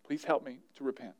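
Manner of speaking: low, soft, sorry plea; quiet prayer. Shown as a demonstration. Pastor models repenting in the moment, soft and low. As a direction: pray it low and humble. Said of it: "run to God and say".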